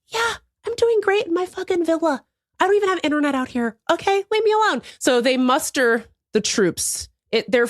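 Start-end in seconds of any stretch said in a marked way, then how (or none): 0.1-4.8 carefree rich self. Pretend. Imagined future self in villa.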